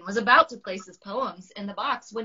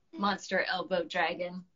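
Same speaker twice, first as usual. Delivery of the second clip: plain reading voice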